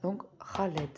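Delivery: throaty Arabic ra at the start of Khaled. Shown as a demonstration. Teacher showing the class Khaled's throaty Arabic ra. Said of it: "C'est vraiment au fond de la gorge".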